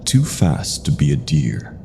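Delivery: plain reading voice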